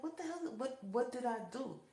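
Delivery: high, slowed, pleading disbelief; plead, baffled and hurt; bewildered protest. Retold or imitated. After the beating, confused.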